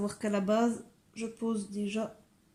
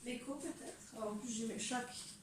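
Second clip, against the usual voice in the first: normal talking voice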